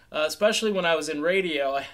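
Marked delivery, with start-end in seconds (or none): none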